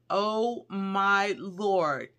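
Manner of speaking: ordinary talk, nothing marked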